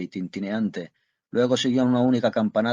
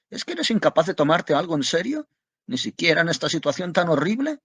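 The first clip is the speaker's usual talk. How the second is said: higher, louder reproach; exasperated scolding; 8 semitones higher, 3 dB louder than usual. A narrator's reading. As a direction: scold him for joking now. Wife scolds husband in crisis. (reproche exasperado, más agudo)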